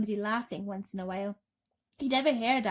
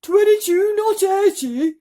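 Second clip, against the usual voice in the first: much higher, louder shriek; 12 semitones higher, 16 dB louder, slower than usual. Acted. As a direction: shriek the correction. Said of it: "shrieked the earthworm".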